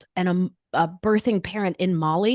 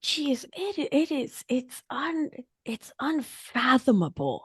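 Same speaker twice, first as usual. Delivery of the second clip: awed whisper; 5 semitones higher, 4 dB quieter than usual. Real, not acted.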